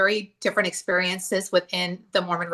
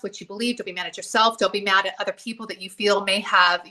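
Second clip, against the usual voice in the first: normal talking voice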